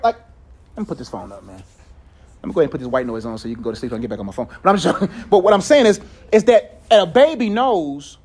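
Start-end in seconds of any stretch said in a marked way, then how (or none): 0.8-4.5 low, hushed and quick aside; muttered aside. Retold or imitated. Dad giving up on phone beside watchful baby, muttered aside. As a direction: mutter it low, half to yourself.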